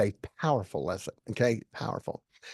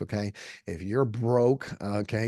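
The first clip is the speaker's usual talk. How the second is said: ordinary talk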